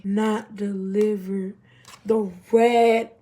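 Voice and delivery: loud, drawn-out reading of a phone alert; dramatic reveal. Retold or imitated. Reading the failed text off her phone, dramatic reveal. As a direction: spell out the bad news slowly and loudly.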